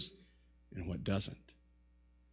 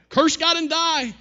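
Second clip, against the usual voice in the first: high, loud, drawn-out scolding; mock wifely yell, pitched way up; nagging wife; 14 semitones higher, 8 dB louder, slower than usual. Job's wife scolding her miserable husband, nagging. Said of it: "a wife who nagged him".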